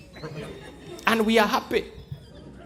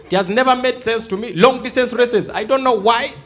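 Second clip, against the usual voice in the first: normal talking voice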